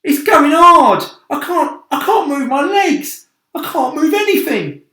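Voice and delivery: high, loud despairing moan; moaning centipede. A narrator's reading. Centipede moans that the paint is drying, high loud lament. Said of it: "he moaned".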